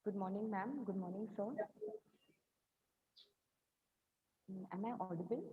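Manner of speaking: faint, far from the mic; distant, too quiet to hear; barely audible. Real, not acted. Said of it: "Your voice is very low".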